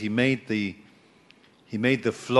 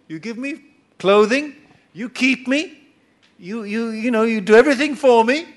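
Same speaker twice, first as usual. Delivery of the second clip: higher, louder, eager bargaining voice; Jacob bargaining with God; 9 semitones higher, 7 dB louder than usual. Retold or imitated. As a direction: haggle with God like a cheeky trader. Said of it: "he says to God".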